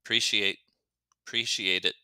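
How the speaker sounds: "Appreciate it" starting straight on "pre", like a contraction; reduced "preciate it"; dropped "uh". Shown as a demonstration. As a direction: clip off the first vowel of "Appreciate". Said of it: "take off this "uh" sound".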